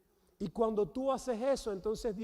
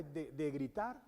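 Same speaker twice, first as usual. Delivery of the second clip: ordinary talk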